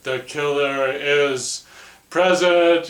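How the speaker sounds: slow, high and loud, drawn-out seance warning; Piper Laurie voice. Retold or imitated. Mother's taped seance voice, slow eerie proclamation. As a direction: intone it like a medium possessed. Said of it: "in Piper Laurie voice".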